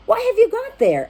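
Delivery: high, lively piggy question; Pig's voice. Acted. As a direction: pop up high as a curious Pig. Pig questions Frog in story.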